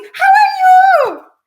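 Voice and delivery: high, slow, overdone "How are you?" in a put-on English accent; mock English accent. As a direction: mimic a stiff, rehearsed English greeting. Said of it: "ela só sabe falar assim". (sotaque inglês caricato, agudo e lento)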